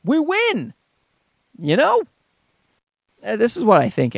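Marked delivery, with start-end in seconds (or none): 0.0-0.8 high, excited cheer, the wife's genes celebrating; mock victory cheer. Wife's genes cheering at the baby, excited high cheer. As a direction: squeal it out like you just won. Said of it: "her genetic code screaming".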